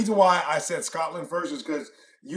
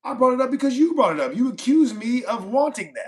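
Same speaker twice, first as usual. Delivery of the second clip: louder, higher-pitched argumentative pushback; heated retort; 8 semitones higher, 6 dB louder than usual. Real, not acted. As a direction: throw the accusation back, voice raised. Heated argument, throwing back an accusation.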